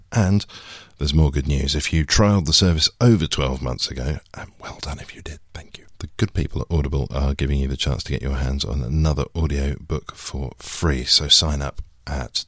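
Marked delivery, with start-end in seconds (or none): 4.3-8.1 conspiratorial whisper; whispered aside. Acted. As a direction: whisper it like a secret tip. Whispered aside in sponsor read.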